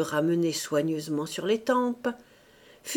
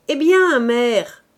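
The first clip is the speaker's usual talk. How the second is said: loud, high, irritated shout down the stairs; irritated call; 4 semitones higher, 9 dB louder, slower than usual. A narrator's reading. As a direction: bark impatiently from above. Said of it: "une voix irritée".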